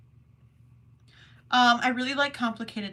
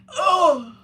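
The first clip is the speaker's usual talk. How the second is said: exasperated plea; 4 semitones higher, 7 dB louder, slower than usual. Retold or imitated. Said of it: "and I'm like".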